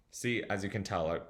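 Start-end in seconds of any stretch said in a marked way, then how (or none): none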